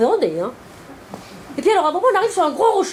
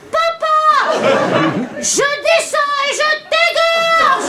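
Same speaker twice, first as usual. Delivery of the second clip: high, stretched-out yell with a laugh behind it; mock terrified yell; 11 semitones higher, much slower than usual. Son dangling over the void yells at his father, high mock scream. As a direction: howl it like a scared teen threatening his dad. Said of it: "On l'a entendu hurler".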